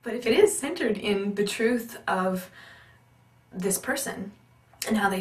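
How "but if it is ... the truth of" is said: ordinary talk, nothing marked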